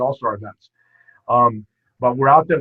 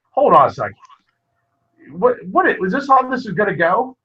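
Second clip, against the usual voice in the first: indignant protest; 7 semitones higher, 3 dB louder than usual. Retold or imitated. Florida coach protests seeding.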